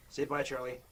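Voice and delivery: ordinary talk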